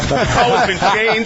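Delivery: mock ref, stretch the wait before changed; Jeff Triplett. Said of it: "it was Jeff Triplett saying".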